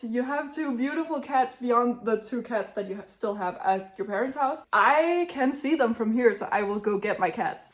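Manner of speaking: normal talking voice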